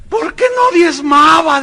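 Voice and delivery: high, loud, drawn-out put-on lament; mock crying wail. Preacher voicing damned woman explaining herself, exaggerated mock wail. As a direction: wail it slowly in parody. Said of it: "que lloraba y gritaba".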